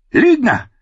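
high, sudden cry of accusation; accusing outburst. A narrator's reading. Queen cuts off the lying singer, furious. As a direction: cut him off angrily.

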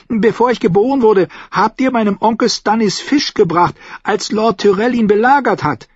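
put-on high child's voice; high-pitched little lord; boy explaining. A narrator's reading.

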